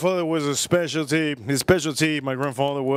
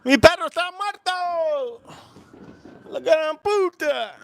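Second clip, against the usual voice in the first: very high exclamations in a put-on Spanish voice; hammed-up high Spanish outburst; high-pitched mock cursing; 13 semitones higher than usual. Mock Spanish cursing amid fireworks.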